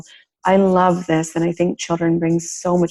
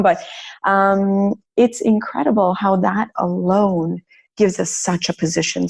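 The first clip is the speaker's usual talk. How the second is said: usual speaking voice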